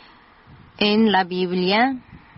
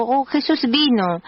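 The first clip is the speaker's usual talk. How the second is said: raised, higher call of recognition; retold shout; 5 semitones higher than usual. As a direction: call out that it is Jesus. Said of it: "gritó". (grito alegre y agudo)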